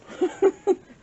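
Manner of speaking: a light burst of laughter; short laugh. Real, not acted. Joking about starting a collection, a quick laugh. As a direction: laugh at your own joke.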